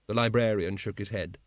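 usual speaking voice

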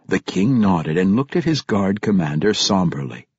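plain reading voice

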